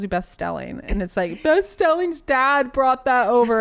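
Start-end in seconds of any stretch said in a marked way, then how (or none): 1.4-3.6 slow, loud, high mock wail; crying child. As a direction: play an upset kid complaining tearfully. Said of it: "she's, like, crying".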